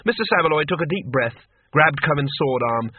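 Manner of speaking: usual speaking voice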